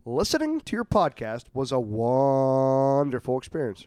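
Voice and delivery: mock reverent reading. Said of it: "It says".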